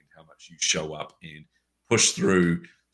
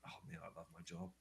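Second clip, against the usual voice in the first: hushed, awed sigh of joy; whispered delight at her job; 27 dB quieter than usual. Retold or imitated. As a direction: breathe it out in wonder. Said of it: "I just went".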